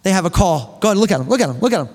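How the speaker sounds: usual speaking voice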